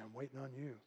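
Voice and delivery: soft, low inner voice of God; hushed divine reply; little voice. Retold or imitated. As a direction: answer very quietly and low. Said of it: "I hear this little voice saying".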